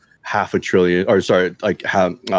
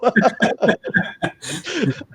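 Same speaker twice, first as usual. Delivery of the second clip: high, helpless giggling laugh. Real, not acted. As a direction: giggle it out, can't stop. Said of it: "I can't say it with a straight face".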